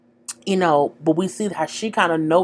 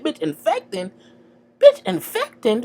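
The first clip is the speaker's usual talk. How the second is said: outraged repeat; 12 semitones higher than usual. Retold or imitated. Outraged retort to quoted insult.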